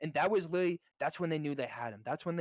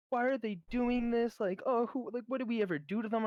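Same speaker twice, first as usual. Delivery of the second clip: mock whiny question; 8 semitones higher than usual. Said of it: "people are like".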